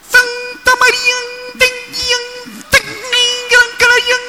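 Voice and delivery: very high, ringing metallic words. Shown as a demonstration. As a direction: speak like clanging metal. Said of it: "palabras metálicas".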